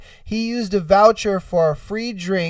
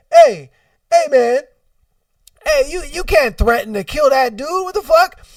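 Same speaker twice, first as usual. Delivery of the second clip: raised, incredulous challenge; officer intervening; 5 semitones higher, 4 dB louder than usual. Acted. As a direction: call out loudly, then protest in disbelief.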